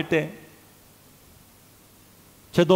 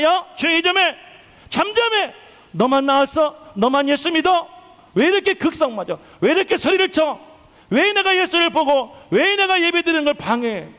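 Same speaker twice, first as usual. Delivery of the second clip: high, raised-voice angry rebuke; scolding shout; 8 semitones higher than usual. Acted. Man in the crowd silencing Bartimaeus, scolding shout. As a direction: yell at someone to shut up. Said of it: "갑자기 그 무리 중에 한 사람이 소리를 칩니다".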